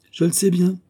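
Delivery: ordinary delivery, nothing marked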